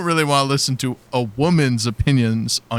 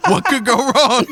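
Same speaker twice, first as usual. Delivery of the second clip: laughing; 11 semitones higher than usual. Real, not acted. Laughing at own joke.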